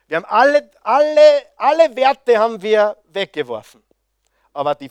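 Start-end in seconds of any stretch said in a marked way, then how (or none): 0.1-3.8 higher and louder, a fervent preacher's cry; impassioned preaching. Real, not acted. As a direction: build it into a passionate call. Preacher rising to climax.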